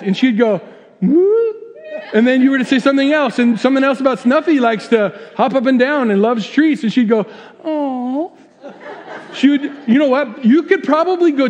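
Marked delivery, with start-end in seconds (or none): 1.0-1.5 very high "woo" imitating the wife; wife's wordless woo. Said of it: "Polly would do this". 7.6-8.3 high drawn-out "aw" imitating the wife; wife's cooing aw. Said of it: "Polly would do this".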